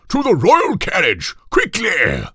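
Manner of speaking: king ordering his friends to the carriage, loud and high; commanding king. A narrator's reading. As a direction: order everyone off like a pompous king. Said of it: "That settles it".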